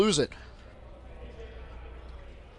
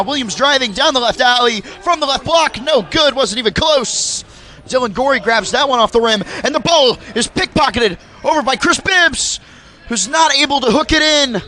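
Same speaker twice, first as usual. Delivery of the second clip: excited call; 7 semitones higher, 7 dB louder than usual. Acted. Drive, miss, steal.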